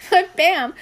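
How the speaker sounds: breaks into a laugh mid-read; laughing through the name. Real, not acted. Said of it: "now I'm all tongue-tied".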